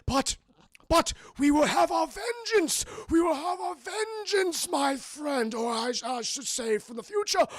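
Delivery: high, loud theatrical villain voice; shouted mock-villain speech; hammy villain. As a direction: ham up a gloating movie villain. Villain monologue retold in film recap.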